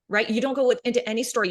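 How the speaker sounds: ordinary talk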